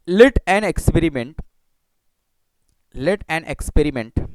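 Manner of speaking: very slow, each word drawn out; slow dictation. Shown as a demonstration. Teacher dictating to students while writing, very slow. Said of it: "धीरे धीरे मैं पढ़ रहा हूँ".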